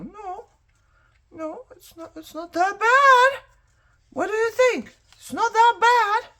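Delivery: high squeal. Real, not acted.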